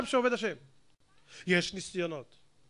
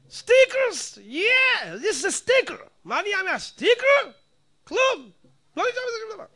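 high, loud exaggerated cheering; mock excitement; 12 semitones higher, 5 dB louder than usual. Rabbi mocks people excited over slogans, much higher and louder. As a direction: ham up a giddy fan of stickers. Mocking sticker enthusiasm.

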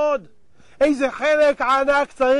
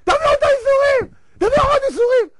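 very high, loud desperate demand; frantic acted shout; 13 semitones higher, 7 dB louder, faster than usual. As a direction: yell it over and over at the top of your range. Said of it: "עכשיו משה צועק".

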